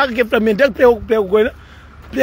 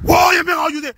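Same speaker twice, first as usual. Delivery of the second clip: calling out; 5 semitones higher, slower than usual. Retold or imitated. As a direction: call out to get José's attention. Calling out to José.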